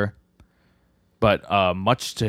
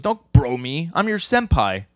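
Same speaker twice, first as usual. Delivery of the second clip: mock indignant rebuke; 6 semitones higher, 5 dB louder, slower than usual. Acting out senior rebuking junior.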